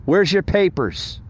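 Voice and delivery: usual speaking voice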